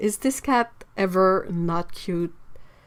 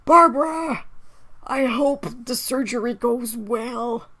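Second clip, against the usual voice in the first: put-on slow, creaky old man; slower than usual. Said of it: "Could I possibly get an old man voice?".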